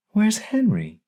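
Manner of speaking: put-on high, anxious old-woman voice; a confused grandma pleading for her husband; grandma asking for Henry. A narrator's reading. Grandma clawing at her grandson's shirt, high and anxious. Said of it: "she said, clawing at his shirt".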